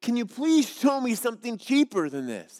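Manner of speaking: mildly exasperated demand; a touch of impatience at the clerk; frustrated. Acted. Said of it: "now he's getting frustrated".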